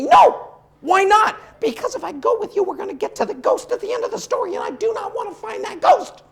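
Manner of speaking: high, fast whiny refusal; pleading to stay home, voice up high; scared stubborn horse. Acted. Horse begs the storyteller not to go find the ghost, high and whiny.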